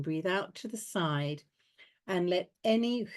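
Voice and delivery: calm, paced breath count. Acted. Leads breathing exercise.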